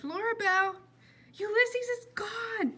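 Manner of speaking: high, slow desperate cry; screaming. Acted. Girl telling a boy her squirrel is gone, high cry. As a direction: cry out the bad news. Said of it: "she screamed".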